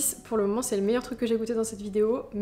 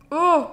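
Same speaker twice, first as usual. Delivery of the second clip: disgusted cry; 6 semitones higher, 11 dB louder than usual. Real, not acted. Tasting disgusting frozen gummy.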